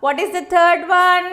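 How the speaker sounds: ordinary talk, nothing marked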